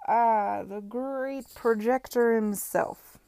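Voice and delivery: slurred tipsy greeting, slow; drunk slurring. Acted. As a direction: drawl it out like a drunk. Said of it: "his voice slurring".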